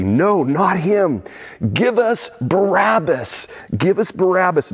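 high, slow crowd yell for Barabbas; crowd shouting back. Retold or imitated. Preacher voicing the crowd answering Pilate, a slow high yell. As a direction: shout it out slowly like an angry mob. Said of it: "they shouted back".

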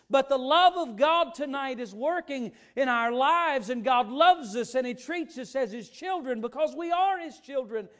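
loud, high-pitched pulpit shout; full-force preaching. Acted. Preacher voices an angry God to congregation, full-force preaching. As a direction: drive it home at full preaching volume.

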